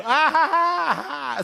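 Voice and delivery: high, forced mock laughter; fake laugh. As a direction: laugh falsely to cover your fury. Fake laugh covering fury.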